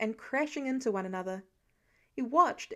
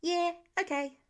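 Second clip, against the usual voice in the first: higher, cheerful agreement; enthusiastic; 6 semitones higher than usual. A narrator's reading. Kid told to shut up, still eager and higher. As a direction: brush off the rebuke brightly. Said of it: "how was he still so enthusiastic".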